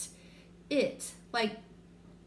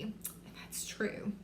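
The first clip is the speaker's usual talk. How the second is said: whisper; 9 dB quieter, slower than usual. Real, not acted. Aside after example.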